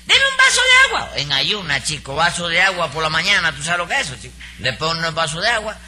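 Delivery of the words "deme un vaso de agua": hammed-up falsetto of a fussy customer; mocking Nananina's voice. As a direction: mimic the customer in a shrill falsetto.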